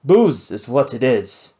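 slow, gruff pirate retort. Acted. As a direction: throw it back at him like a drunk pirate. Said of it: "the pirate retorted".

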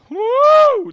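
high, loud "woo"; excited whoop. Real, not acted. Host cheering the idea of a European tour, excited whoop. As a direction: let out a big high whoop. Cheering during the roll call.

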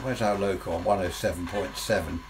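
ordinary delivery

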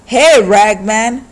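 slow, loud drawn-out call. A narrator's reading. Thug calling to the old man in the dark, loud and slow. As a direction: call up the dark stairs slowly. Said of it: "a voice called".

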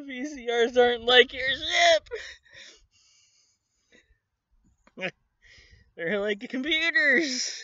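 very high, loud, fast exasperated plea; exasperated scolding. Real, not acted. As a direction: yell at the screen in disbelief.

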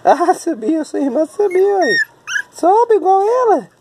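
squeaky-high excited encouragement; high-pitched cheering. Real, not acted. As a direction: cheer the little one on in a very high voice. Cheering little ones climbing. (torcendo com voz bem aguda)

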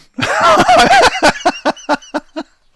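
very high squeaky cackle; sped-up evil cackle. Pretend. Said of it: "I have fun".

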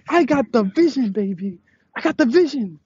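high, excited crowing; thrilled and high-pitched; hyped celebration. Real, not acted. Hyped celebrating after the stunt.

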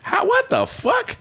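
very high, slow squeaky outburst; stunned disbelief. Retold or imitated. Watching a fat dude do backflips, a high slow shocked squeak. Retold reaction to fat acrobat.

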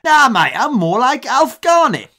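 loud, high London caricature; hammed-up good-natured London geezer; strong London accent. Good-natured London manager replying to fan, caricatured London accent. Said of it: "he's got a real London accent".

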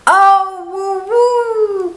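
put-on high, loud child cry; little boy voice. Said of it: "cried the little boy".

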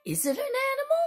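ordinary delivery

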